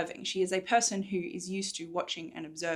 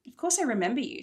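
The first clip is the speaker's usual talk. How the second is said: angry; 6 semitones higher, 5 dB louder, faster than usual. Acted. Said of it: "feeling her anger rise".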